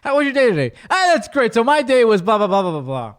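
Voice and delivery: loud, high mock small talk; breezy talking-over. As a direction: brush off the answer and barrel on. Demonstrates talking over someone's answer.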